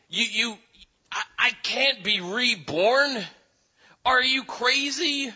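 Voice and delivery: outraged disbelief, are you crazy; incredulous. Retold or imitated. Nicodemus protests to Jesus about rebirth, shocked shout.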